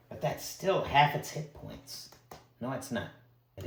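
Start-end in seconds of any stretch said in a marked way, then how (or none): none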